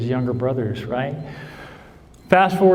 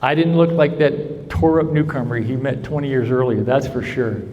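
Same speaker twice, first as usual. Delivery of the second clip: ordinary talk, nothing marked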